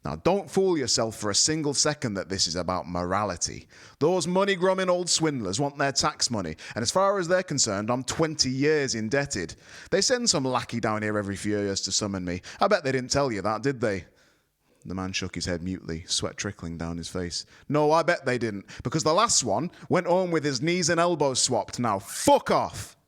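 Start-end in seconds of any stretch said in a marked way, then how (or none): 0.0-14.1 higher, louder contemptuous rant. A narrator's reading. Wizard ranting at envoy. 17.7-21.8 higher, louder contemptuous rant. A narrator's reading. Wizard ranting at envoy. 21.8-23.0 slow, louder barked dismissal; shout. A narrator's reading. Wizard dismisses envoy.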